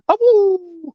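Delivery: high howling awoo; wolf howl. Pretend. Wolves fan howl.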